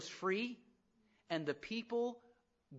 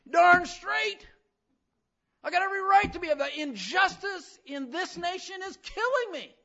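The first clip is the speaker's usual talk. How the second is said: much higher, loud defiant yell; angry crowd shout; 12 semitones higher, 9 dB louder than usual. Retold or imitated. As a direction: shout back like an outraged protester. Angry crowd answering back.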